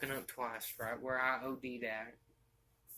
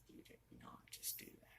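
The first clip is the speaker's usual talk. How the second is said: hushed disbelief, much softer; shocked whisper; 19 dB quieter than usual. Retold or imitated. Girlfriend seeing the baggie, whispering in shock. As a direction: breathe it out in stunned disbelief.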